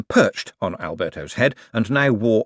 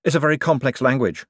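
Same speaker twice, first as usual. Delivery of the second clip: hurried, higher-pitched deflection; a quick, raised-pitch cover-up; quickly; 7 semitones higher, 4 dB louder than usual. A narrator's reading. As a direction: jump in quickly to change the subject. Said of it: "said Adora Belle quickly".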